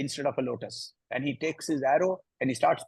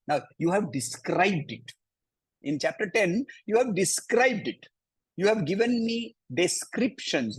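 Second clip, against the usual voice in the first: voicing Arjuna's plea; 6 semitones higher than usual. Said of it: "He's saying".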